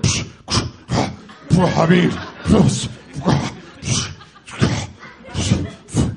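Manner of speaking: voiceless huffs and puffs of outrage; spluttering indignant noises. Retold or imitated. Customer spluttering at the stranger, the sound of indignant injustice. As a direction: imitate someone too affronted to form words, spluttering. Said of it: "he made a series of noises".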